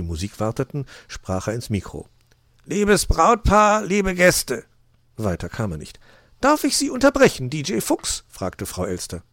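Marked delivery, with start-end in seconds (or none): 2.7-4.6 slow, loud, raised fox voice; stately, loud greeting to guests; microphone announcement. A narrator's reading. 6.4-8.2 very high magpie voice; high, officious bird lady; Frau Elster. A narrator's reading. Magpie interrupts the fox.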